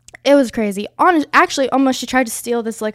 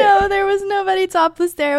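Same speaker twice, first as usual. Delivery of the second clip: laughing; 10 semitones higher, 4 dB louder than usual. Real, not acted. Laughing denial.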